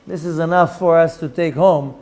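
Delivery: ordinary talk, nothing marked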